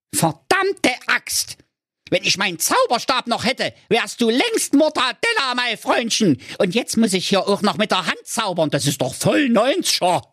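very high, shrill-sounding witch voice; a raging old witch scolding the youth; furious witch. Acted. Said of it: "Da rief sie". (sehr hohe, wütende Hexenstimme)

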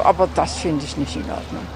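normal talking voice

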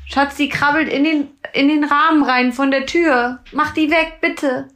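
high, loud frightened pleading; panicked about a spider. Real, not acted. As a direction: beg him to kill the spider, panicking. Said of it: "ich krieg richtig Schiss".

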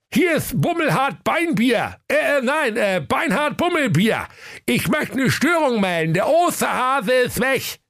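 high mayor voice, stumbling; flustered mayor. Acted. Mayor reports a fault to the Grimm hotline, high and flustered. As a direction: trip over your own name. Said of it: "Der Bürgermeister sprach".

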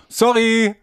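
a cheeky apologetic chirp; high fake apology. Acted apology at night.

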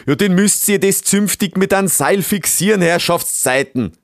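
loud, high outburst, slowing on the curse; exasperated scolding. Acted. Beaver master tells helpers to use rope, exasperated shout. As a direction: snap orders in annoyed dialect.

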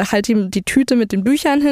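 usual speaking voice

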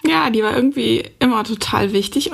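ordinary delivery

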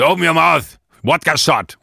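pushy waiter imitation. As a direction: push the shot on her like a pushy waiter. Staff insisting on shot.

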